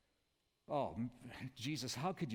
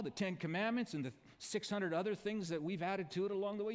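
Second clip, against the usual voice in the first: higher, louder, hammed-up accusation; religious men confronting Jesus in a raised mock-accusing voice; accusing crowd; 3 semitones higher, 4 dB louder than usual. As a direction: jab it at him like a mocking accuser. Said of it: "And they say".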